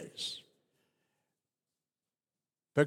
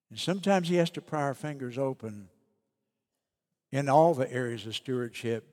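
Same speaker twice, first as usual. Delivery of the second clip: ordinary talk, nothing marked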